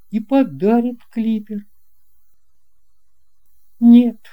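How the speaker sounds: plain reading voice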